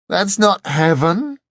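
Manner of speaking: high, loud, slow outraged shout. A narrator's reading. Angel shouting at Dor as the door pulls, outraged. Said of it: "it shouted".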